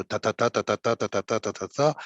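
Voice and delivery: even ta syllables marking the alexandrine beat; rhythm tapped out. Shown as a demonstration. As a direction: count out the metre evenly. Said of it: "c'est l'alexandrin".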